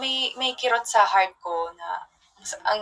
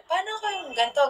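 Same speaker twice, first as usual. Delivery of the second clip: mimicked question; 8 semitones higher, 4 dB quieter than usual.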